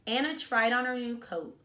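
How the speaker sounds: ordinary delivery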